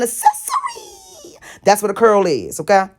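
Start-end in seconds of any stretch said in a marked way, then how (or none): 0.0-1.4 squeaky voice. Pretend. Mock high aside.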